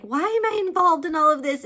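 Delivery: loud, high, fast mock exasperation; frantic why-me outburst; exasperated heroine. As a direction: blurt it out like a fed-up girl. Author voicing heroine's exasperation.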